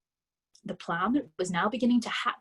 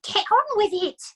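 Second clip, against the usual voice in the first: much higher, louder bossy urging; wife pushing husband to act, urgent; urgent mother; 12 semitones higher, 8 dB louder than usual. A narrator's reading. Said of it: "hissed the mother".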